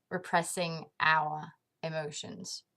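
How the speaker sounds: usual speaking voice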